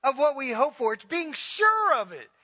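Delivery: high, loud, hammering preacher's cry; fiery preaching. Acted. Pastor urging the congregation toward faith, preaching at full force. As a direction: preach it out to the whole church.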